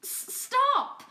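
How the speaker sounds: high, loud stammered plea; pinned cat begging the attacker to stop; panicked cry. Acted. Fern pinned, crying out.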